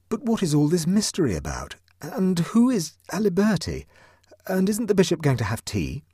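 high, fussy and bewildered; flustered Huddle voice. A narrator's reading.

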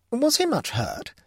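high, slow lady's question; the Baroness asking. Acted. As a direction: lift it up high and ask slowly like a concerned lady. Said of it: "asked the Baroness".

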